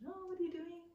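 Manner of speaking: mock innocent phone call to husband; sweet innocent. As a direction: pretend nothing's up. Calling husband on phone, teasing.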